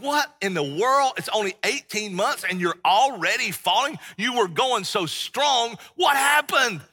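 like a betrayed mentor; Paul hearing the churches are falling, exasperated; exasperated disbelief. Retold or imitated. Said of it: "he's like".

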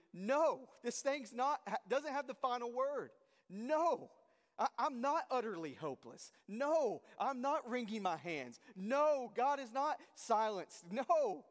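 defiant shouting. Acted. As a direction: shout each No like a battle cry. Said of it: "It's shouted".

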